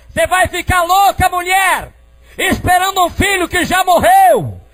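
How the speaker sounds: high, loud scolding from afar; father's shouted retort. Retold or imitated. Father retorting to mother from the corral, retold as high shout. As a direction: shout it back across the yard. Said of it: "meu pai retrucou de lá".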